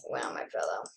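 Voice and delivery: whispered; a soft unvoiced whisper. Real, not acted. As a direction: breathe it out in a whisper.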